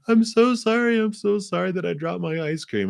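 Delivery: put-on high, crying child's voice, apologising over and over; sorry grandchild. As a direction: play a little kid who just dropped an ice cream. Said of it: "and they're like".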